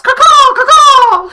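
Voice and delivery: very high, loud shrieking caws; shrill screeching bird. Acted. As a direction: screech like a giant crow. Mock fans cawing.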